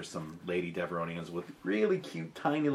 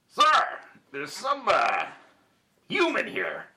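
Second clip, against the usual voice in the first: high, swooping Deveronian bartender; halting Deveronian phone report, pitched high; Deveronian accent; 11 semitones higher, 5 dB louder than usual. Pretend. Bartender phones boss about a delivery, high Deveronian accent. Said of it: "all Deveronians are gonna talk like this".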